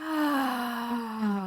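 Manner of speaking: long, soft voiced sigh. Shown as a demonstration. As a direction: let out a drawn-out "ah" sigh. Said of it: "let out a sigh".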